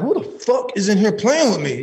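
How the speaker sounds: high-pitched, heated demand; retold angry challenge. Man alone in shelter room challenging unseen laugher, retold angry outburst. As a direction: act out confronting a hidden prankster.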